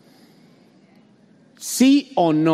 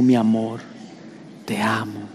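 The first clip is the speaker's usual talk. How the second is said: tender; 6 semitones lower, 4 dB quieter than usual. Retold or imitated. Voicing a husband to his wife.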